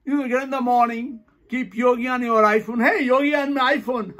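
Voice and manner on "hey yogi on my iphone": higher, gleeful call; excited mock greeting. Shown as a demonstration. Teacher greeting the yogi on the phone, mock excitement. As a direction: call it out gleefully before laughing.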